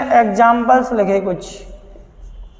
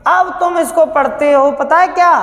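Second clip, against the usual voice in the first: ordinary talk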